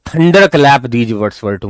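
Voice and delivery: slow, deliberate textbook reading; slow reading aloud. Shown as a demonstration. Teacher reading the story slowly to students. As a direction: read each word out slowly for the class.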